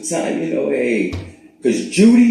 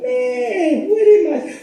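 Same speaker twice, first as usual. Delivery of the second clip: high, laughing startled wake-up cry; startled kid; 3 dB louder, much slower than usual. Retold or imitated. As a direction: yelp it like a kid jolted awake. Kid woken by mom, retold.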